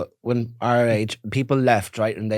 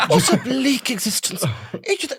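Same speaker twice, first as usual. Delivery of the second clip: hammed-up pity, pitched way up; mock pitying snob; 13 semitones higher than usual. Teasing friend about working class people, mock-horrified high voice. Mocking someone pitying the poor.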